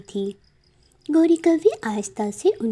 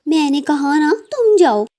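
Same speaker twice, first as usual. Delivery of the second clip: higher, louder, impatient; annoyed wife crow; irritated; 5 semitones higher, 4 dB louder than usual. Acted. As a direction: get rid of him. Wife snapping at husband.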